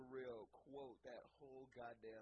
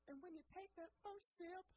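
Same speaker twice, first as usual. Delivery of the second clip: high, dreamy swoon. Retold or imitated. Guest savoring the first sip, high and dreamy. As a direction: float up on the words. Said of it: "I'm getting a little emotional".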